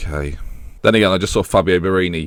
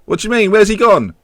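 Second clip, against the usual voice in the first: squad player; 11 semitones higher, 4 dB louder than usual. Acted. Acted squad questioning.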